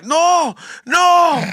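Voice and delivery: put-on high, loud, drawn-out "no" of a kid; upset child's yell. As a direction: imitate a little kid hollering in protest. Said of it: "and he started crying".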